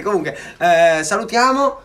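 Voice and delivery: ordinary talk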